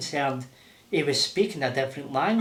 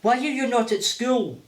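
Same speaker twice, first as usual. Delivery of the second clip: higher-pitched mimicked French accent; Isabel's French accent; 6 semitones higher than usual. Boss's wife questions boy about school, imitated French accent. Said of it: "love it when he does Mummy's accent".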